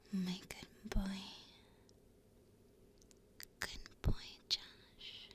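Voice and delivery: unvoiced whisper of praise. Real, not acted. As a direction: whisper it close to the mic. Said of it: "I can whisper you some names".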